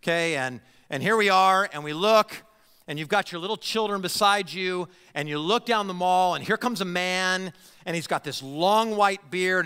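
ordinary talk, nothing marked

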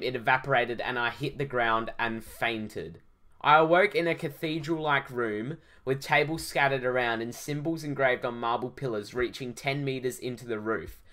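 ordinary delivery

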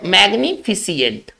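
"मैग्निफिसेंट" with an extra i, fi-si-ent; common mispronunciation. Shown as a demonstration. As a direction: say "मैग्निफिसेंट" slowly as mag-ni-fi-si-ent. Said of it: "बोलते कैसे हैं". ("मैग्निफिसेंट" का गलत उच्चारण, फि-सि-एंट)

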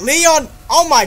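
amazed to hear Leon; excited. Real, not acted. Excited at game character.

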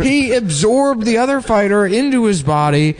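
a hint of an inspirational announcer. Continues mock announcer speech.

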